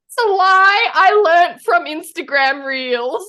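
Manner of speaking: high, loud comic outburst; mock confession. Client retelling a confession to their therapist, high mock outburst. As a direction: blurt it out, slow and laughing.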